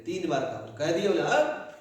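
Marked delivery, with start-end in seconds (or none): 1.2-1.8 high, loud burst as he sits up; spring to life with a yell; sudden shout. Acted. Corpse suddenly jumps up. (अचानक उछलकर ज़ोर की आवाज़)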